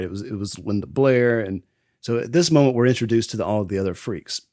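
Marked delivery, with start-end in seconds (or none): none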